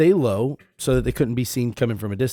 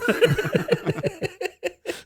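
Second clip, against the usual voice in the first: squealing laugh, higher; high shrieking laugh. Real, not acted. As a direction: burst out laughing. Big laugh at the bit.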